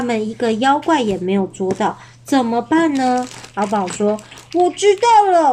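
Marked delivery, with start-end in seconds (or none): 4.5-5.5 兴奋想到. Acted. 阿宝想到主意.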